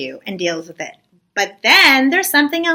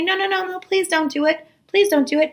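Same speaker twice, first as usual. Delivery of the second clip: higher, softer acted begging; quick, gentle mock plea; mild pleading; 7 semitones higher, 5 dB quieter than usual. Moshe asks Hashem not to punish the people, pleading. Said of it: "Moshe tries to say".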